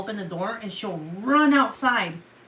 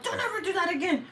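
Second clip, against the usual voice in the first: very high, overwrought scolding; mock breakdown; 11 semitones higher than usual. Owner clutching her cat, high overwrought scolding. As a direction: wail the telling-off, hammed up. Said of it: "had, like, a mini breakdown".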